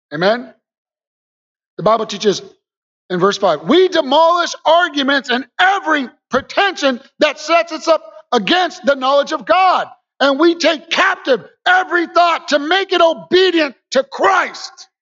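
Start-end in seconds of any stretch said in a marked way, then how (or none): 3.6-14.9 shouted scripture. Acted. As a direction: proclaim it like a battle cry. Preacher reads scripture at full force.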